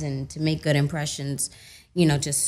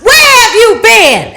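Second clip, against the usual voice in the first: excited; 18 dB louder, slower than usual. Retold or imitated. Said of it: "came and yoked me up".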